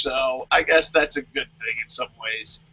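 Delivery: usual speaking voice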